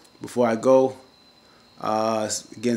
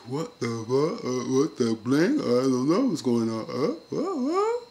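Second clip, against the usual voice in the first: bewildered Jeffrey; 2 semitones higher, slower than usual. Acted. Imitating Jeffrey clueless at the confession, bewildered. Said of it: "Jeffy looks like".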